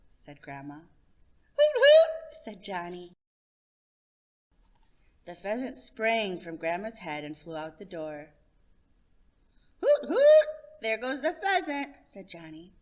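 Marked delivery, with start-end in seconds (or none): 1.5-2.3 high, loud hooting call; a boy calling like a bird. Acted. 9.8-11.9 very high loud hooting then cheering; a boy watching the bird fly; excited hoot. Acted. Child excited call.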